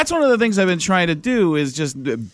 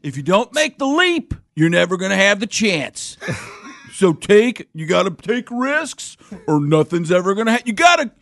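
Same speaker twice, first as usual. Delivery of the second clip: motivational speaker. Acted. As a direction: fire up a seminar crowd in parody. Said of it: "the motivational speaking thing".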